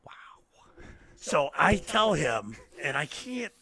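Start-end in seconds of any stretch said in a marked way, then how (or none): 0.1-0.8 hushed whisper. Real, not acted. Retelling a student's crying.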